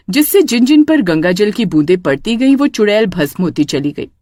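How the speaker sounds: ordinary delivery, nothing marked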